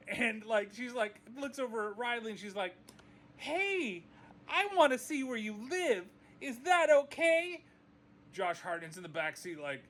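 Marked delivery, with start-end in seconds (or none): 3.4-7.6 sweet Raven voice. Retold or imitated. Voicing Raven asking daughter.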